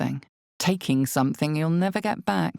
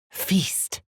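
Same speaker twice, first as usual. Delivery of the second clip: hissed hungry command; 5 semitones higher than usual. Acted. Hungry inner voice urges feasting.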